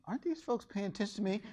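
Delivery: hushed, high, quick question; quick high aside, barely voiced; voiced inner thought. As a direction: wonder it softly to yourself. Her private thought, voiced.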